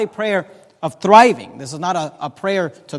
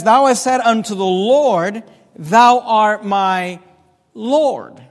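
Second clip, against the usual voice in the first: solemn intoned reading; 2 semitones higher, 3 dB louder, much slower than usual. Acted.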